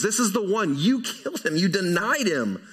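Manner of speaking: lightly acted preacher's accusation; accusing scold. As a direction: drive the charge home. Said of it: "don't think you read the inflection here that way".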